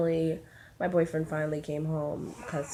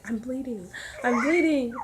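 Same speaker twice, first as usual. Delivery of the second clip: high-pitched mock panic, rising on the repeat; retold freak-out; 6 semitones higher than usual. Woman retelling seeing blood to her boyfriend, freaking out. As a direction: imitate freaking out, higher the second time. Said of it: "I was freaking out".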